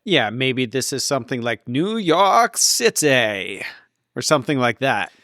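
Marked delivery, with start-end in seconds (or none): 1.7-3.6 stretched, slow New York City in a put-on accent; drawn-out New York accent. Guessing the band's real destination, drawn-out put-on accent. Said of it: "I need you to say that in a Canadian accent".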